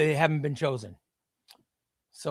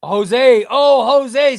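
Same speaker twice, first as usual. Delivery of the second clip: much louder, rising to a higher mock lament; dramatic callout; 5 semitones higher, 10 dB louder, much slower than usual. Real, not acted. Streamer mourning a viewer's elimination, loud mock lament. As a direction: wail the name in mock dismay.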